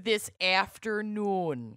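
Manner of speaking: "This afternoon." slow, with flat Minnesota vowels; Minnesota accent. Shown as a demonstration. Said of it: "we'll break it down".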